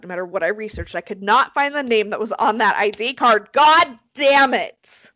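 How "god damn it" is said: loud, slow exasperated curse; emphatic venting, half in jest; pissed off. Real, not acted. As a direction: drag it out in frustration. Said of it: "really pissed me off".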